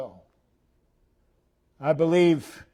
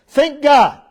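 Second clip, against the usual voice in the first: 1 loud exhortation; 10 semitones higher, 11 dB louder than usual. Real, not acted.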